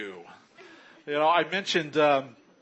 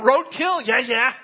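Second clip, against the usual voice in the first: eager, bouncy dog voice, pitched up; excited dog; 7 semitones higher, 7 dB louder than usual. Pretend. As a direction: play a dog thrilled by anything.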